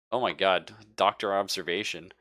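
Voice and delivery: ordinary talk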